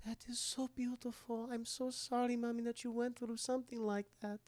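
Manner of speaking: almost hush it, sweet and sorry; soft sympathy. Retold or imitated. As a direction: Esther comforts her new mom, hushed and sweet. Esther consoling mother softly.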